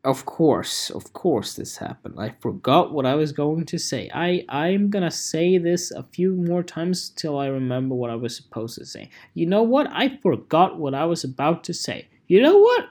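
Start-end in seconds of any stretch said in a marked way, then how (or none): 0.9-2.1 whispered self-annoyance. Real, not acted. Annoyed at forgetting. 12.3-12.8 high squeak. Real, not acted. Trying again to remember.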